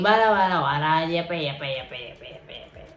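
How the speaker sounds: low, dismissive babble fading down; mocking blabber. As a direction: imitate his excuses as droning nonsense. Mocks his endless excuses.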